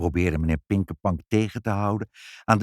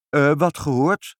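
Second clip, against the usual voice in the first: higher, louder puzzled question; feigned puzzlement; 8 semitones higher, 5 dB louder than usual. A narrator's reading. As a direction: ask back in wonder. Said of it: "vroeg moeder verwonderd".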